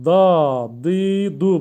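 da, di, du said syllable by syllable; Arabic letter demo. Shown as a demonstration. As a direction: sound out da di du clearly. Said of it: "Bakın".

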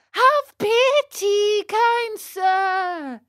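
very high, loud, slow wail; shrieked imploring boy puppet; desperate puppet plea. Acted. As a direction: beg at the top of your little voice. Pinocchio begs again, louder.

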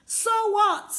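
high dismissive so what; defiant retort. Acted. Preacher mocking the spies' fear, high and defiant. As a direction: brush off the giants.

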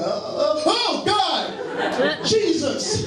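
high-pitched comic panic; mock-terrified yelp to God; panicked cry. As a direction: call out to heaven as you slip. Acts out panic slipping in shower.

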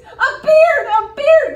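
loud and high, laughing through it; gleeful cry. Acted. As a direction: crow it out in mocking triumph. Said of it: "cried clever Nicholas".

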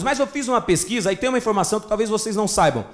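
normal talking voice

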